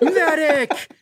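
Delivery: high, hammed-up battlefield cry for a medic; mock call for a medic. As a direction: yell for the medic like a war movie.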